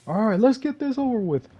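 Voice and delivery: high, loud pumped-up mock voice; psyched up. Gamer psyching himself up before a fight, high and loud. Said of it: "I was like".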